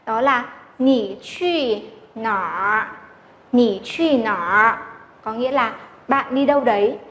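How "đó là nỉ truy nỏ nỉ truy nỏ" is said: "nỉ truy nỏ" said slowly and precisely as nǐ qù nǎr; slow teacher demo of "nỉ truy nỏ"; careful model reading. Shown as a demonstration. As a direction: read "nỉ truy nỏ" slowly and correctly. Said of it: "hãy đọc một cách chuẩn ra".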